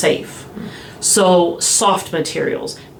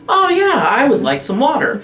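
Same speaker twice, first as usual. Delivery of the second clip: mock surprised delight; 8 semitones higher than usual. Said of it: "you're like".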